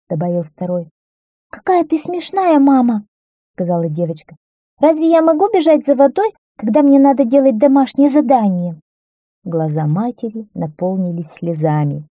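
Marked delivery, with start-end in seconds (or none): 1.5-3.1 high-pitched daughter brushing mum off; dismissive little girl. A narrator's reading. Daughter voiced, dismissing her mother. 4.8-8.8 high, indignant schoolgirl voice; protesting little girl. A narrator's reading. Daughter voiced, protesting an errand.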